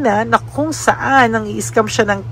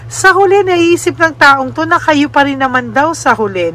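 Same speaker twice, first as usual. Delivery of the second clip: usual speaking voice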